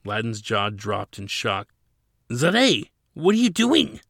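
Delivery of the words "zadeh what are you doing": much higher, louder alarmed cry; shocked. A narrator's reading. Ladin stunned as Zadeh surrenders, shocked high cry. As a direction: gasp it out in disbelief.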